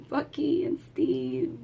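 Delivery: low breathy whisper of the names. Real, not acted. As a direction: whisper it like it hurts. Whispered names of lost heroes.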